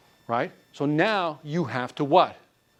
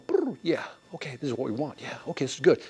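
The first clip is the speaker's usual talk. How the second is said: pigeon couple; 2 semitones lower, 5 dB quieter than usual. Retold or imitated. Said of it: "like pigeons going".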